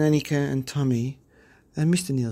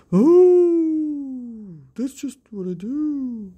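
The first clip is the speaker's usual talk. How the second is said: high, loud wailing ghost; spooky ghost; 12 semitones higher, 6 dB louder, much slower than usual. Acted. Ghost explains.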